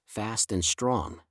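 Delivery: plain reading voice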